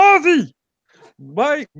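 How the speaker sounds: very high frog-puppet cry, loud at first; Kermit the Frog. Acted. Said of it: "to say as Kermit".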